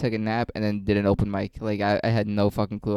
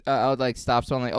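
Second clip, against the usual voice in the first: ordinary talk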